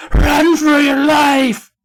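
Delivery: very high, drawn-out shriek; hammed-up scream. Todd warns everyone as the ground shakes, long theatrical scream. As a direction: scream every word out slowly, melodramatically. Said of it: "Todd screamed".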